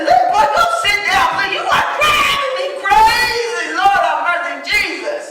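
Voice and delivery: high, loud exasperated scolding; hammed-up fed-up parent's outburst; mad parent. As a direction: act out losing your temper at a child. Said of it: "when I get mad and say".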